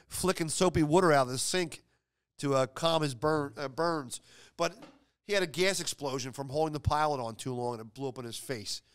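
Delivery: usual speaking voice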